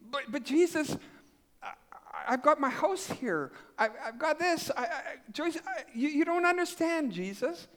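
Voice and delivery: high, stammering, backpedaling protest; flustered pleading. Retold or imitated. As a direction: stumble over excuses, pleading up high. Stammering excuses to Jesus.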